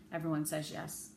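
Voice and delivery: plain reading voice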